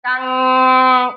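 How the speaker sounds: कंग said long and loud with a ringing nasal ng; nasal sound held. Shown as a demonstration. Teacher answering her own question for students, drawn-out nasal. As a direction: hold कंग, hum the ng through the nose. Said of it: "छोटी अंग को कैसे बोला जाता है".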